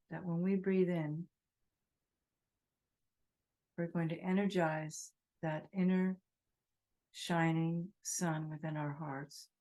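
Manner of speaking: calm meditation voice, spacious pauses; guided meditation. Real, not acted. Teacher guiding breath and inner sunlight, guided meditation. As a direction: lead them gently through the breathing.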